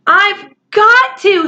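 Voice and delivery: breathless, strained plea; panting. A narrator's reading. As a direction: gasp it out, out of breath. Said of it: "he panted".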